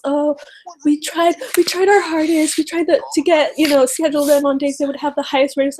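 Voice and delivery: high, whiny mock excuse; mocking Debbie Wasserman Schultz. Vlogger mocking the chair's excuses, high and whiny. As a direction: parody a fake-sincere official, pitched well up. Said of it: "freaking Debbie Wasserman Schultz".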